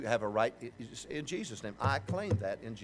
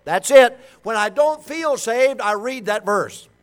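fervent preaching; 6 semitones higher, 10 dB louder than usual. Acted. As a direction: preach it with conviction to the church. Preaching at full force.

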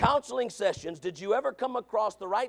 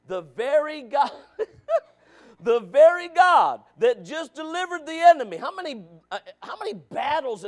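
loud, higher, laughing in disbelief; fiery preaching; 5 semitones higher, 7 dB louder than usual. Acted. Preacher marvels loudly at the people's ingratitude, laughing. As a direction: shout it with an incredulous laugh.

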